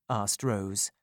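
plain reading voice

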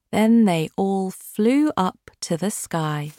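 usual speaking voice

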